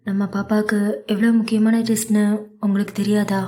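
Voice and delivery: plain reading voice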